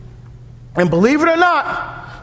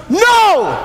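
emphatic no; 6 semitones higher, 3 dB louder than usual. Real, not acted. Emphatic rejection.

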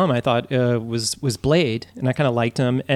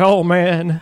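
[name] drawl; 3 semitones higher, 4 dB louder than usual. Retold or imitated. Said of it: "He's like".

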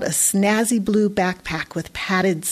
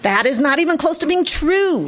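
higher, sharp indignant retort; snapped; 7 semitones higher than usual. A narrator's reading. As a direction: snap it back, offended. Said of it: "Roderick snapped".

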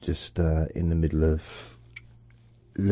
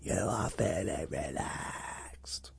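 slow, hushed whisper shout; stage whisper; 9 dB quieter, much slower than usual. Shown as a demonstration. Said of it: "that's kind of whisper shouting".

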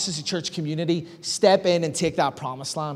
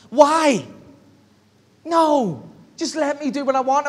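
put-on higher, a protesting toddler's whine; mock-whiny little girl, higher; 6 semitones higher than usual. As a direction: play the child who wants her own way. Toddler whining when told no.

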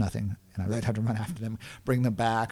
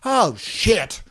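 high, loud, alarmed mock panic; panicked; 10 semitones higher, 5 dB louder, much slower than usual. Trainer hears the act goes to Korea, panicked. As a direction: ham up his horror at forgetting Korea. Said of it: "And he's like".

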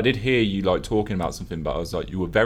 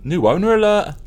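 higher and louder jingle-style announcement; mock news alert; 10 semitones higher, 7 dB louder than usual. Host announcing the club takeover like a news alert. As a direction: call it out like a breaking news sting.

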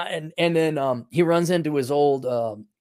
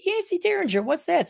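much higher, puzzled "what's that?"; puzzled question; 13 semitones higher, 3 dB quieter than usual. Retold or imitated. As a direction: ask it high and baffled. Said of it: "I said".

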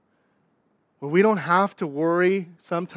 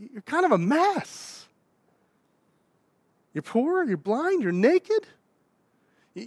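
higher, emphatic challenge from the pulpit; preaching rebuke; 5 semitones higher, 3 dB louder than usual. Acted. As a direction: confront them with what they really are. Preaches rebuke in Jesus' words.

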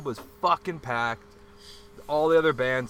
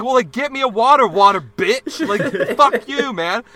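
much higher, louder, slowing on fuck you; retold furious shout; 9 semitones higher, 10 dB louder than usual.

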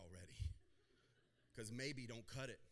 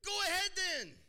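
much higher, louder; hammed-up gung-ho yell; eager friend; 13 dB louder than usual. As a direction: cheer the friend on. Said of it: "My Bible says".

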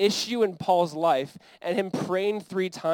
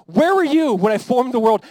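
loud, higher booming rebuke; thundering challenge; 5 semitones higher, 9 dB louder than usual. Acted. God confronting Job about creation, thundering. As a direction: thunder it as an almighty questioner.

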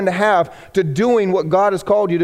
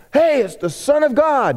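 mock shout; 7 semitones higher than usual. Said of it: "somebody shouted".

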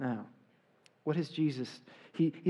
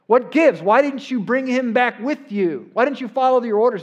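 raised, high, acted-out reprimand; indignant questioning; 7 semitones higher, 8 dB louder than usual. Officials challenging soldiers who came back without Jesus, acted indignant. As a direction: demand answers in a loud, pitched-up retelling.

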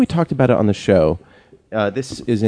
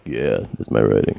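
deadpan reply; 12 semitones lower, 4 dB quieter than usual. Retold or imitated. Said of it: "And I'm like".

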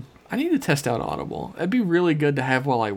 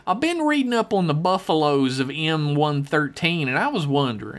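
folksy hillbilly letter-writer. Acted. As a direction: read it as a rambling backwoods yokel. Reads listener email in character.

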